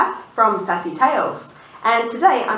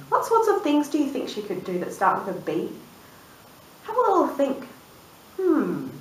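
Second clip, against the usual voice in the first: ordinary delivery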